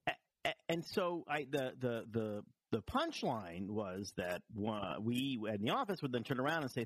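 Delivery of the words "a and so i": ordinary delivery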